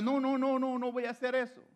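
high, insistent string of refusals; acted refusal. Acting out a frightened refusal.

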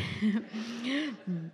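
quiet amused laugh; little laugh at her own joke; soft laugh. Real, not acted. Preacher joking about her dress, soft laugh.

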